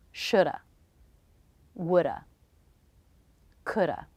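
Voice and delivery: shoulda woulda coulda each ending in a soft schwa; careful teacher demo of shoulda woulda coulda; reduced modal forms. Shown as a demonstration. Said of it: "reducing the ah vowel to the schwa".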